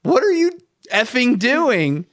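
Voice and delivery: high-pitched mock outrage, slowing on the last words; imitated yelling. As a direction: imitate an exasperated wife yelling. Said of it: "Samantha's yelling at me".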